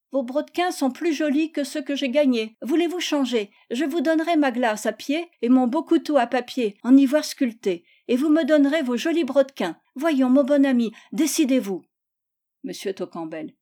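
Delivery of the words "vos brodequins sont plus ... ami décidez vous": higher, eager bargaining voice; insistent child. Acted. Gisèle offers Tocambel a swap, insistent. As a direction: press and wheedle like a child.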